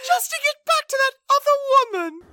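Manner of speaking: very high, fast, wailing; mock Emily. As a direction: shriek it like a jilted bride. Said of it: "Emily is heartbroken".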